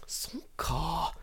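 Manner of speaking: whispered fake-amazed "so ka"; mock whispered wonder. Teacher fakes whispered amazement at Tanaka's answer. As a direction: breathe it in fake awe. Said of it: "I'm like".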